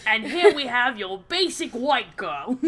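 mock Australian wildlife presenter; Steve Irwin. Co-host presenting the passenger like wildlife, playful Australian accent. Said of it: "like having Steve Irwin drive me".

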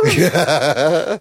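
short laughing "Ah!" yelp; a comic cry of being hit; half-laughing mock yelp; mock pained yelp. Gambit knocked out cry.

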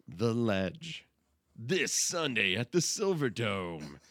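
slow, booming event ad announcer. Pretend. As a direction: sell it like a monster truck ad. Mock event ad guess.

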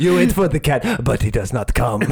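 theatrical mock French accent; Christophe. Studio head describing his art film, mock French accent. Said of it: "in his heavy French accent".

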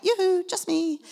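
high, sing-song "yoo-hoo" call; cheery friend calling. Retold or imitated. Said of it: "a friend calling out".

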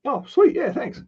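high, pleased customer accepting a treat; delighted thanks. Retold or imitated. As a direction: sound thrilled at the offer.

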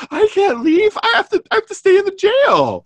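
squeaky high panicky jailer; hammed-up high-pitched jailer voice; silly high jailer.

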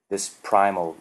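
plain reading voice

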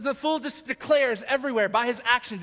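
ordinary delivery

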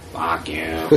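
low, put-on masked-hero voice; Batman. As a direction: drop it into a mock vigilante voice. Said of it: "doing that fucking voice".

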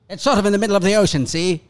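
put-on quick, high copy of a Mid-Atlantic accent; imitating Mid-Atlantic accent. Friend copies the Mid-Atlantic accent he just heard. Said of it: "I like that accent".

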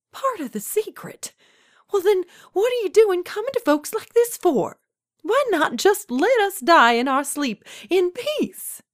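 very high-pitched, incredulous protest; indignant. A narrator's reading. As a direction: throw the answer back and rail at him. Old woman indignantly challenges Death.

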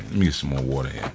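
ordinary talk

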